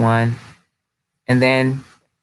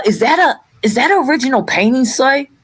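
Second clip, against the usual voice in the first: higher-pitched detective impression, quick question; Columbo; 8 semitones higher than usual. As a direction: put on a detective's voice, pitched up and inquisitive. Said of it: "I'm gonna do the Columbo voice".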